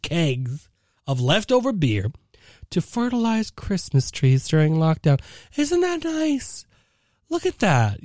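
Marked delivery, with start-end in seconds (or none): 5.6-8.0 mock sweet. Sarcastic aside on news.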